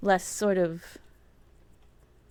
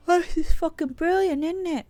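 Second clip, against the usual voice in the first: excited; 10 semitones higher, 6 dB louder than usual. Real, not acted. Delighted at game progress.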